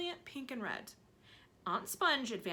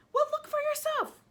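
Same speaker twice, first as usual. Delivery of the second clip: aunt voice; 7 dB louder than usual. Acted. Aunt insisting peach grows.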